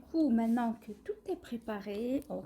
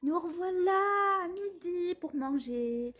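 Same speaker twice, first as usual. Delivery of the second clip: cheerful greeting; 7 semitones higher, slower than usual. Real, not acted. Bright return greeting.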